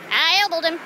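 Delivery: exaggerated Florida accent on "elbowed". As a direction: lay on a comic Florida twang. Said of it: "My Florida accent's coming back".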